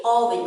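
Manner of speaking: "oven" opening on aw; short O pronunciation. Shown as a demonstration. Teacher repeating it for children, short-O oven. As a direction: say "oven" as aw-vin. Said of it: "we say oven".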